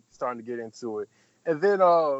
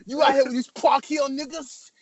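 loud, higher angry street voice; 9 semitones higher, 4 dB louder than usual. Retold or imitated. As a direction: come in hot, accusing. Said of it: "here go Ghostface".

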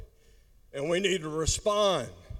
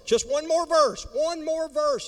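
pleading; 12 semitones higher than usual. Acted. Pleading high repetition.

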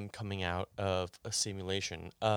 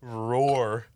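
imitating a roar; 5 dB louder than usual. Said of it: "You basically just went".

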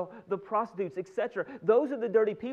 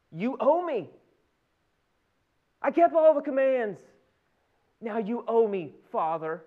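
jealous, entitled tone; 5 dB louder, slower than usual. Acted. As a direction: insist that the debt be paid. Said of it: "almost hear it in their tone".

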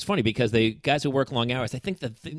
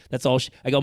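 ordinary talk

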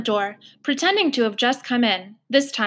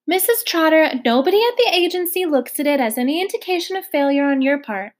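higher, louder and quick, trying to placate; anxious caseworker talking fast; pleading caseworker; 6 semitones higher, 5 dB louder than usual. Acted. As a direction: rush to soothe an upset foster mother.

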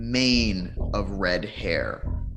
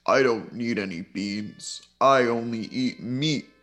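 unearthly voice. Acted. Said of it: "says the spirit".

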